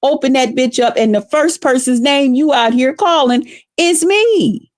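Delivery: ordinary delivery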